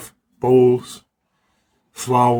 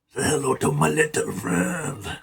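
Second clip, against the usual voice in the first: lightly voiced Tony Montana gangster line; a light mock of the Scarface gangster, spoken a little softer; Scarface impression; 2 semitones higher, 3 dB quieter than usual. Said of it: "This is like I'm in Scarface".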